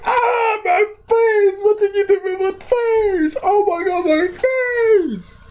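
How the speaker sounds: very high, hammed-up crying wail; falsetto wail. Thug wails very high about his face. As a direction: shriek over a ruined face. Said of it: "he's like".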